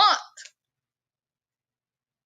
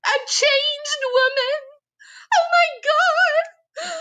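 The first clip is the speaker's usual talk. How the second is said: much higher, squealing mock girl voice; excited little girl; 11 semitones higher than usual. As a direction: gush like a starstruck little girl, high and shrill with tears. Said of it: "how excited the little girl was".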